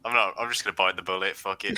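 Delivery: usual speaking voice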